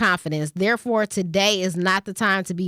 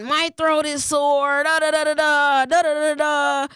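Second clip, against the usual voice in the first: mocking sick coworker; 6 semitones higher than usual. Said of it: "sniffling, snorting, sneezing".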